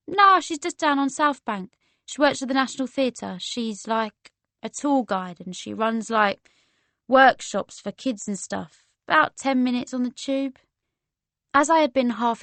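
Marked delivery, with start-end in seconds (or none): 0.1-10.6 laid-back London chat; casual London woman. Acted. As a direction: explain helpfully in a London accent.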